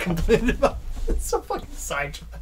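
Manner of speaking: laughing through the words. Real, not acted. Laughing mid-sentence.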